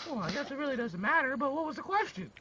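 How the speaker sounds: curious mom probing; mom's voice. Acted. Mom asks the question.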